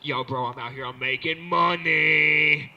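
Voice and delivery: lazy braggy dealer drawl, slow and loud at the end; bro dealer. Retold or imitated. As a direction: brag like a small-time dealer. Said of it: "They're like the".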